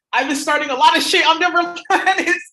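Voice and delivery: cracking up at her own claim; loud laughing. Real, not acted. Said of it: "laughing loud at the end".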